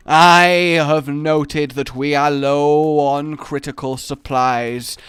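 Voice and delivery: slow, loud, grandly intoned proclamation; epic intoning voice. Acted. Announcing a trip to the shops for milk, epic intoning. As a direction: proclaim it like a mock-epic quest. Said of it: "my intonation voice there was".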